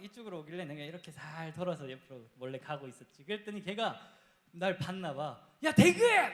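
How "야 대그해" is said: loud, high shout across the distance, "야, 대그해!"; calling out. Retold or imitated. Friend spotting him on the stairs, loud calling shout. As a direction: yell his name from far off.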